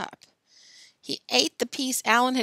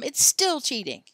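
raised, loud insistence; shouted; 5 semitones higher, 5 dB louder than usual. A narrator's reading. Tom holds the others to the rules, insistent shout. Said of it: "shouted Tom".

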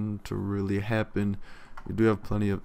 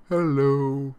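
higher and louder put-on hello; mock greeting voice; 6 semitones higher, 6 dB louder than usual. Player greets the arrested traveler, mock welcome voice. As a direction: sing out a playful fake welcome. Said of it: "Hello, Castro".

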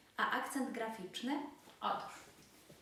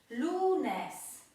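Lunes with a raised, forceful first syllable; penultimate stress; 5 semitones higher, 6 dB louder than usual. Shown as a demonstration. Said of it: "akcent właśnie pada na".

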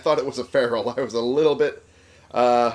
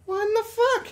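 shocked. Real, not acted. Shocked by glitch in game.

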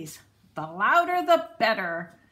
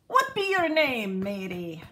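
gruff pirate question, louder start; pirate asking; 3 dB louder than usual. A narrator's reading. Head pirate asking the boy his name, gruff pirate voice. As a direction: growl it like a pirate captain. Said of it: "the head pirate asked".

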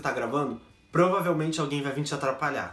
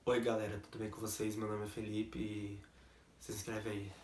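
much softer, lower, timid mumble; shy first video; 8 semitones lower, 13 dB quieter than usual. Retold or imitated. Imitating his shy first video, very soft and low. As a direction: mumble it like a nervous beginner.

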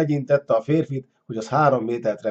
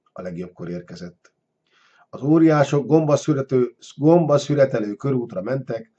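plain reading voice

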